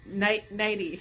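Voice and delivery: "nighty", ninety without its middle N; mispronounced ninety. Shown as a demonstration. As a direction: drop the second N, saying "nighty". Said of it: "I used to say".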